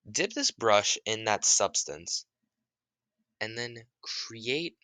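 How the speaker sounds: slow, deliberate command; slow instruction. Retold or imitated. Naruto teaching the kid, slow and deliberate. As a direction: spell it out slowly.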